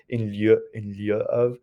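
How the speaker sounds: in lieu said with a French accent. Shown as a demonstration. Teacher admitting how she always wants to say it, French accent. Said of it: "say it with a French accent".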